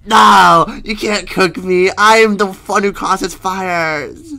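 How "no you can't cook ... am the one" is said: loud high protest; shout. Real, not acted. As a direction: yell it in protest. Protest being cooked.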